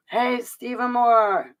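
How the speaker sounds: high, slow, drawn-out greeting; delighted hail. Real, not acted. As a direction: call out happily to a long-missed friend. Greeting a viewer who just appeared.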